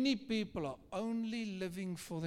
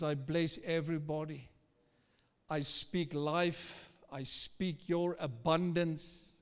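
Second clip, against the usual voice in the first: prayer; 2 semitones lower than usual. Real, not acted. Pastor prays blessing over people.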